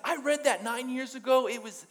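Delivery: high-pitched gushing recollection; imitated excitement. Brother recalling the book to his sister, excited. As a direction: act out an amazed fan's reply.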